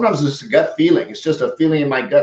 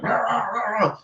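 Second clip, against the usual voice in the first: mock ranting; 9 semitones higher, 6 dB quieter than usual. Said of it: "He was like".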